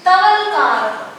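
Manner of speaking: Talavakar said very slowly and clearly; dictating Talavakar to students; slow, careful. Shown as a demonstration. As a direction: draw out Talavakar for the board. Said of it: "मैंने लिखा".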